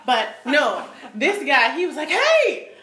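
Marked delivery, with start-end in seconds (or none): 2.1-2.7 high, louder eager greeting; excited stranger. Retold or imitated. Imitating the train station guy, excited and eager. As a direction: imitate an overjoyed guy waving hands.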